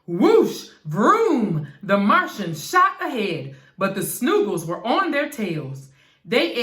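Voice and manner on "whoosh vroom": loud zooming effects; whoosh and vroom. A narrator's reading. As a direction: roar off like rockets. Story sound effects.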